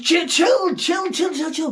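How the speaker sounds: high, soothing repeated chill; the angel calming a panicked Gideon. Retold or imitated. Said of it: "the angel's like".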